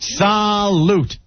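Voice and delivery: high, drawn-out catchphrase salute; Hee Haw salute. Retold or imitated. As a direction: sing it out like a country TV toast. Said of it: "as they used to say on Hee Haw".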